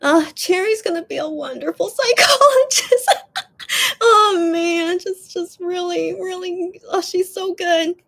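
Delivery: high, tearful voice breaking into sobs; choked up, words cracking between cries; real crying. Real, not acted. Streamer moved by a fan's kind comment, crying. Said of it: "that makes me so emotional".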